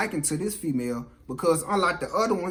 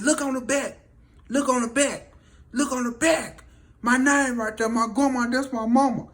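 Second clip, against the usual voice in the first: high-pitched pleading, louder toward the end; acted desperate urging; 8 semitones higher, 4 dB louder than usual. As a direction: beg them urgently, imitating a man about to be shot. Man begs before being shot.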